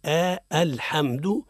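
isolated "آ", then slow الحمد with full hamza; clear light hamza. Shown as a demonstration. Teacher isolates the hamza for learners, slow careful articulation. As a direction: voice a thin clear glottal "a" opening الحمد. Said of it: "بتحقيق الهمزة وترقيقها".

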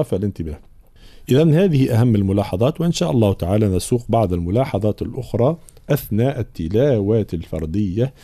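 usual speaking voice

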